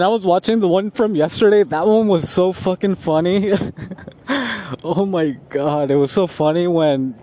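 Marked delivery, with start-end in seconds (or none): 3.6-4.8 a short real laugh breaking out; real laughter. Real, not acted. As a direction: laugh it off at the memory. Laughing after funny episode.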